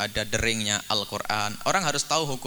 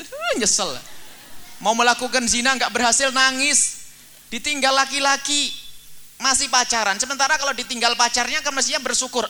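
high, loud sermon climax; fiery preaching; 12 semitones higher, 5 dB louder than usual. Acted. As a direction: preach it hard to the congregation. Preaching at full force.

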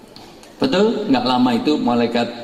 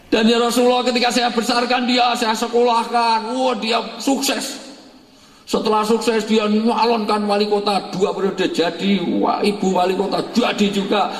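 put-on high, proud old father; father telling the Prophet of his children, proud and high; 10 semitones higher than usual.

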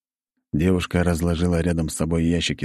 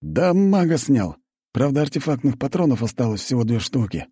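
голос снайпера; 8 semitones higher than usual. Acted. Снайпер доволен выстрелом.

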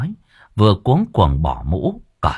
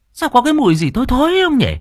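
much higher, shrill-voiced grumbling wife; nagging wife; faster than usual. A narrator's reading. Wife grumbling at her noisy husband. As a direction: complain like an annoyed old wife.

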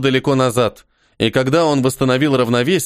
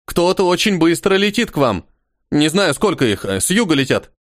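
excited; 6 semitones higher than usual. A narrator's reading. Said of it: "раздался взволнованный голос Толи".

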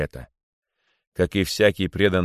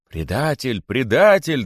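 accusing cries, second one louder; scattered outcries; 5 semitones higher, 4 dB louder, slower than usual. A narrator's reading. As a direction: jeer from the crowd, building. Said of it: "доносились отдельные выкрики". (обвиняющие выкрики)

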